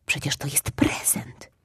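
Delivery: soft whisper to himself; whispered thought. Acted. Cub stopping himself from eating the gift, whisper. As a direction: remind yourself under your breath. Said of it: "pomyślał".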